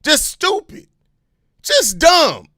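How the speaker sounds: heated scornful outburst; angry insult. Real, not acted. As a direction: berate them, utterly fed up. Insulting the complaining fans.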